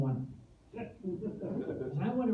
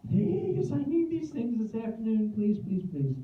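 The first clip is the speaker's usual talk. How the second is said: high, louder plea sliding downward; mock pleading; 4 semitones higher, 4 dB louder than usual. Customer begging the FedEx lady, mock pleading. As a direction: beg in a run that sinks in pitch.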